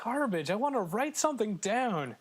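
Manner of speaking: high, whiny comic complaint; hammed-up indignation; mock outrage. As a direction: protest like a playfully wronged gamer. Mock rant about lost passwords.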